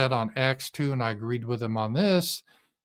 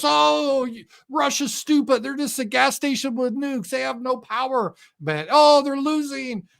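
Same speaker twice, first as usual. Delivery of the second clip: high, sneering parody of a TV pundit; mocking pundit voice; 11 semitones higher than usual. Mocking CBS pundits, high sneering parody voice. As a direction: mimic the smug talking heads, pitched up. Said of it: "people like on C B S".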